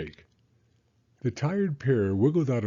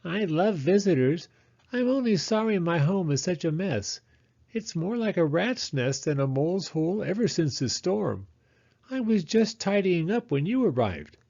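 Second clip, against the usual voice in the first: high, lively little mole; Mole's voice; 8 semitones higher than usual. Acted. Mole welcoming Hedgehog into her messy home, high and chatty. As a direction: chatter like a fussy, friendly mole.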